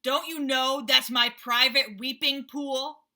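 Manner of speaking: loud, slow angry rhinoceros; deep angry voice. Acted. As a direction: bellow at the intruder. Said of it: "said a deep, angry voice".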